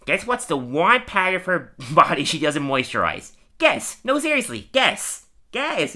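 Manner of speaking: badgering comic voice, climbing high on the repeats; imitating Wiggum. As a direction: play an insistent, chatty cartoon character. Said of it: "and Wiggum's like".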